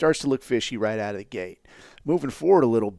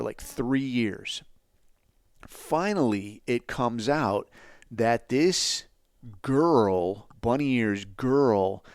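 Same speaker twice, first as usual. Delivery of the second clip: normal talking voice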